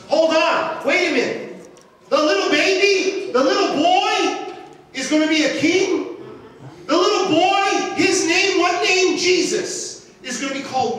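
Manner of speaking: loud, high, ringing sermon voice; preaching at full force. Acted. Preacher lifting his voice to the church, loud and high.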